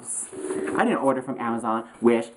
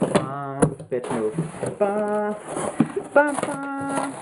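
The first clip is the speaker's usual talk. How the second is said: playful "bah" bleats rising much higher; mock bleating; 6 semitones higher, much slower than usual. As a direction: bleat like a silly sheep. Mock sheep noises while unboxing.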